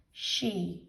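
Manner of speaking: "she" with a long clear E at the end; say its name. Shown as a demonstration. To children, teacher models "she" with the E saying its name. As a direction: stretch the E in "she" so it says its name. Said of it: "so it says its name".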